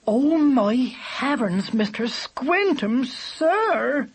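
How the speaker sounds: put-on high, slow, fussing old bird; crying out. A narrator's reading. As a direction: cry out in a high, alarmed bird voice. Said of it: "she cried".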